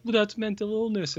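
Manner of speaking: high-pitched mimicry of people objecting; mock objector. People defending the character, mock objection.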